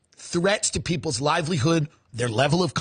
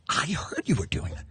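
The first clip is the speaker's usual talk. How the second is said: hushed whisper; 5 semitones lower, 6 dB quieter than usual. Acted. Rich people gossiping about trafficking.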